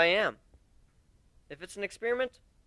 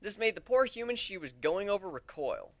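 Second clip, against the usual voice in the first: ordinary delivery, nothing marked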